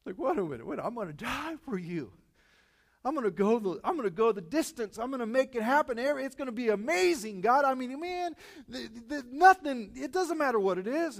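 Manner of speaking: loud, high-pitched, overeager vows; fervent protest. Acted. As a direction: pile up promises in a rising, excited rush. Peter protests he will die for Jesus.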